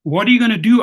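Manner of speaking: retold loud jeer; mock taunt. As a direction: sneer it loud like a tough guy. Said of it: "and he goes".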